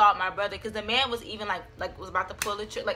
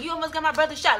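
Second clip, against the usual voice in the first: higher, mildly angry mock yell; retold yelling; 6 semitones higher, 3 dB louder, faster than usual. Confronting her brother's friend, retold angry yelling. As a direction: imitate telling him off, pitched up. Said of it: "Like, I'm yelling".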